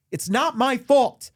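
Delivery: high, slow wounded protest; mock emotional. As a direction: plead it's not your fault, hamming up the hurt. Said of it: "sorry to get emotional".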